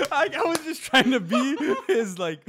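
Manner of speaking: high, loud laughter through the words; laughing. Real, not acted. Laughing while recalling trying to comfort him. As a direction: laugh helplessly while explaining. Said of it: "laughing while retelling".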